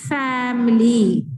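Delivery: family drawn out slowly, syllable by syllable; slow classroom model of family; slow syllable demo. Shown as a demonstration. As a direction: stretch family out so each syllable is heard. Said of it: "अब आप इसको खुद से पढ़िए".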